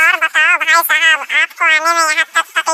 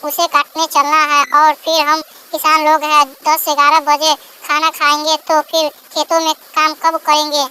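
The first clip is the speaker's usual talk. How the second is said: ordinary delivery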